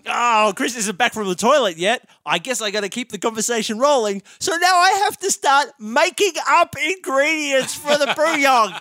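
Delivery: panicking to keep talking; panicked inner monologue. Retold or imitated. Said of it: "I think".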